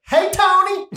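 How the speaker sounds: high, loud Southern twang greeting; Southern accent. Retold or imitated. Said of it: "she had a little bit of a Southern accent".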